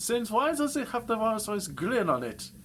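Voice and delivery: mock outraged baron; indignant baron. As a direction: demand it like an offended aristocrat.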